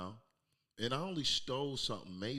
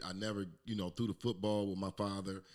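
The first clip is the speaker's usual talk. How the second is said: ordinary delivery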